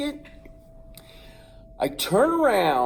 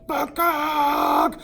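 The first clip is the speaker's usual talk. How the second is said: rooster call; 11 semitones higher, 4 dB louder than usual. Retold or imitated. Rooster charges.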